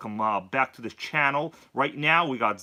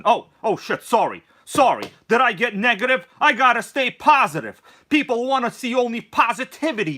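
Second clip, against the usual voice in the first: mock panic; 6 semitones higher, 6 dB louder than usual. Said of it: "All right, kidding around".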